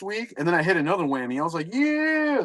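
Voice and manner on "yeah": high excited yelp; sudden high yeah. Retold or imitated. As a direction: cheer at the big hit. Excited card hit reaction.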